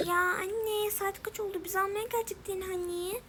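put-on little child's reproachful whine; complaining child. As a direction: whine at mum like a let-down kid. Child complains to mother in roleplay.

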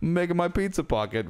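high, clueless and innocent. Retold or imitated. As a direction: play the clueless roommate. Voicing clueless Nick.

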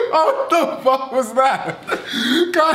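stunned and still laughing; laughing disbelief. Real, not acted. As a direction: drag it out through laughter. Reacting to absurd video.

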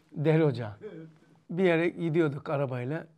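normal talking voice

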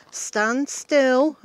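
ordinary talk

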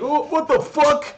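high, loud, sneering imitation with a laugh; mocking teammate voice. Gamer mimicking teammates who mocked him for asking for a shield, high and sneering. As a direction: ham up a jeering teammate. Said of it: "And they were like".

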